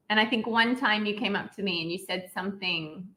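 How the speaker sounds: ordinary delivery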